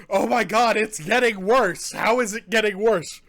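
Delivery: loud, agonized complaint; pained outcry. Real, not acted. Reviewer overwhelmed by pepper burn, loud pained outcry. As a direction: cry out as the burn keeps mounting. Burn worsening, exclaims in pain.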